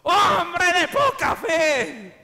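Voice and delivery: slow, high, loud solemn rebuke; voice of the Lord. Retold or imitated. Preacher voicing God's rebuke in his heart, slow and loud. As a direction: intone it like God's voice inside. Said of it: "la voz del Señor que me decía".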